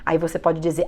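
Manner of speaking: ordinary talk, nothing marked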